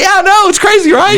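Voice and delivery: high, delighted agreement; giddy. Retold or imitated. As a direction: gush at how crazy it is. Gleeful reply to daughter.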